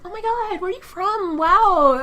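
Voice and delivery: high-pitched starstruck gushing; gushing accent fan. Retold or imitated. Guest imitates Americans infatuated with British accents, high mock gushing. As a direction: imitate someone wowed by a foreign accent. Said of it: "Americans have an infatuation with British accents".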